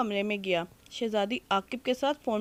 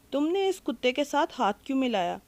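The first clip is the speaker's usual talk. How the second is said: ordinary delivery, nothing marked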